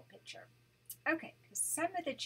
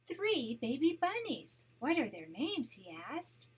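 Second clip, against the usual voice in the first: gentle read-aloud storytelling voice; storybook narration for a grandchild; story narration; 6 dB louder than usual. A narrator's reading. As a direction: read it like a bedtime picture book. Grandma reads picture book aloud.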